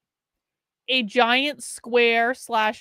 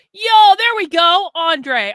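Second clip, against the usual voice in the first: loud, high, drawn-out celebratory yell; excited cheer; 7 semitones higher, 9 dB louder, much slower than usual. Real, not acted. Streamer celebrating a viewer's donation that hits the goal, excited cheer.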